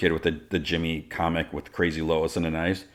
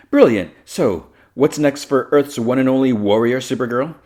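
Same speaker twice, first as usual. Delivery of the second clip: loud, higher stage-host voice; gushing interviewer; 7 semitones higher, 8 dB louder than usual. Acted. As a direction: present her grandly, slowing for the big question.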